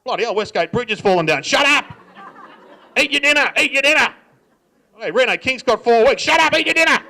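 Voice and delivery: loud, high-pitched dinner-table outbursts; irritable dad erupting into shouts; dad yelling. Acted. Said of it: "he just used to yell at us".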